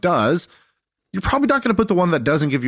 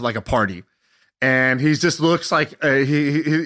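usual speaking voice

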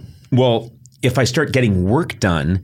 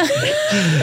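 amused laugh. Real, not acted. Laughs at own bit.

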